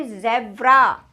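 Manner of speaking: "ज़ेब्रा" slowly as zebra with z; buzzed z. Shown as a demonstration. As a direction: model a buzzing z in "ज़ेब्रा". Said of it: "इसको ज़ेब्रा नहीं बोलेंगे". ("ज़ेब्रा" धीरे, ज़ से)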